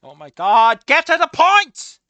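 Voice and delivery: fed-up shout at the rambling character; exasperated yell. Real, not acted. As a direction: demand he get to the point.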